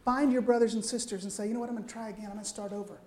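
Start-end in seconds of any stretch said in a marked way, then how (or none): 1.5-3.0 quiet resolve. Acted. Voicing a believer's quiet resolve.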